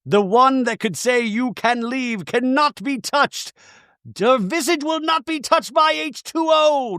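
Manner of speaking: high, slow, drawn-out prophetic declamation; biblical proclamation. Acted. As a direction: proclaim it like scripture, stretching the final words. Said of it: "I think I remember say".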